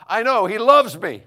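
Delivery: high, slow, very loud retort; exasperated outburst. Retold or imitated. Cutting off the stranger who has news, loud high outburst. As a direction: belt it out over-emphatically, stretching the words. Cuts off her big announcement.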